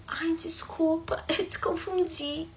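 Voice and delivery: slow, soft whispered apology; embarrassed whisper. Retold or imitated. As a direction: shrink away whispering sorry.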